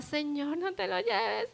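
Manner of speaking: retold weeping plea, voice cracking; tearful prayer. Praying alone as her friend leaves, tearful plea. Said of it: "Y lloraba".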